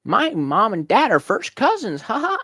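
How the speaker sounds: high, loud, slow southern yokel; drawn-out southern twang, teasing; mock southern accent. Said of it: "I don't have a southern accent".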